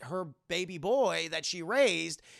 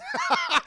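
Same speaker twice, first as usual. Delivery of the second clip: loud high cackle; laughing; 8 dB louder than usual. Real, not acted. Laughs at own joke.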